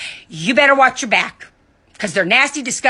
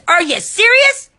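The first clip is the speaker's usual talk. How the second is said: outraged disbelief; 11 semitones higher, 4 dB louder than usual. Real, not acted. Outraged at critics.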